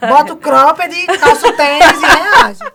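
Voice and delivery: loud, laughing rally cry; loud laughing self-pep talk; hyped laughing pep talk. Acted. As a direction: fire yourself up and laugh through it.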